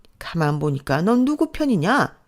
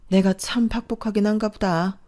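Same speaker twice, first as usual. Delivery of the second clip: ordinary talk, nothing marked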